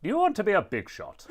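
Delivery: high, loud character voice; Spamton. Acted. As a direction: put on a manic high-pitched salesman. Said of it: "uh, Spamton".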